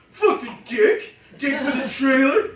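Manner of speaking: loud crowd of extras taunting; mock jeering extras. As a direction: jeer at him as he passes. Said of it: "walk through the extras".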